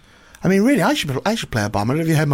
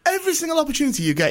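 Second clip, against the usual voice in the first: exasperated rant; 10 semitones higher, faster than usual. Real, not acted. Ranting at Jake.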